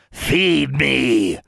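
high, insistent creature voice; demanding creature. A narrator's reading. Creature snuggled by its owner wants food, demanding. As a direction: demand it like a greedy little beast. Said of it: "Honey demanded".

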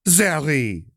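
high, loud joyful cry of a name; exuberant greeting. Acted. Valmora rushing to hug Zariah, exuberant cry. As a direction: burst out with delight at seeing her. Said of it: "Valmora greeted exuberantly".

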